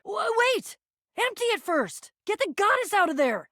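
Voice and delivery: high, panicked command; urgent alarm. Acted. Begging them to get the goddess out first, alarmed. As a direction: jump in high to halt them.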